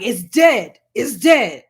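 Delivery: loud, hard-punched dismissal; imitated emphatic cut-off, falling hard on DEAD; retold shutdown. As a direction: end the argument with force. Retold shutting down the argument.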